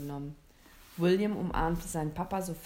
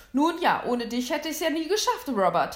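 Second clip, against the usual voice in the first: higher, louder, sly gloating; sly grin; 7 semitones higher, 8 dB louder than usual. A narrator's reading. Hunter gloating at his brother, sly and grinning. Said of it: "sagte der Jäger und grinste hinterhältig".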